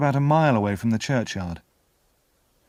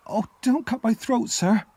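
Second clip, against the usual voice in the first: put-on high-pitched pleading little boy; frightened cry; 12 semitones higher than usual. A narrator's reading. Boy begs the convict for his life, frightened cry. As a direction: plead in terror, voice right up high. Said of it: "I cried".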